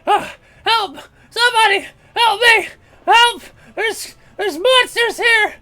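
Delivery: very high, loud desperate cries; orc screaming for help. Acted. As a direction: yell for anyone to save him.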